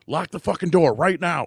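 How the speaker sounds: high, loud urgent command; urgent shout. Retold or imitated. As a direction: bark the order over the radio. Urgent order over earpiece.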